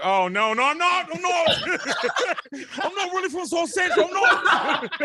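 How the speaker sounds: high, hammed-up backpedalling through laughter; mock panicked denial. Imagined panicked denial when challenged.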